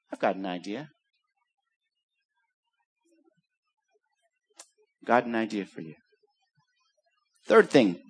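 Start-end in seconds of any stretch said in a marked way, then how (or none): 0.1-5.9 low, hushed offer; almost whisper the idea; softly lowered Jesus voice; quiet. Acted.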